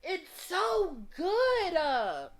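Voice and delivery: loud, high, drawn-out wail; emotional cry of delight; overwhelmed gushing. Real, not acted. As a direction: wail in praise, stretching each word. Player gushing about the game.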